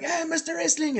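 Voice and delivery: high, eager fan shout; mock crowd call. Crowd at the Arnold Classics hailing the wrestler, excited.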